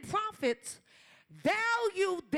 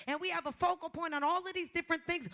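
normal talking voice

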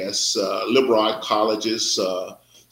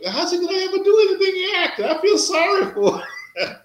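high, fast, laughing mock pity; mock disbelief. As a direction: ham up a pitying joke about his friend. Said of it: "I'm like".

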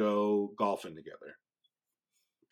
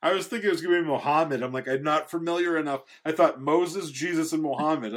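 excited rush; 6 semitones higher, 6 dB louder than usual. Real, not acted. Excited reaction after joke.

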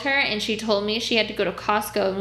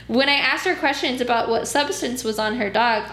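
ordinary delivery

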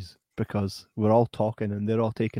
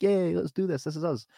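higher, lightly cheering team-player voice; mock enthusiasm; 6 semitones higher than usual. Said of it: "we should always be like".

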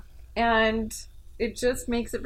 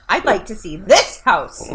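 slow and loud, THIS punched hard; stress on THIS; 8 dB louder, slower than usual. Shown as a demonstration. Showing inflection missing from client texts, "this" hit loud. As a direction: lean heavily on "this", exaggerated. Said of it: "They didn't capitalize this".

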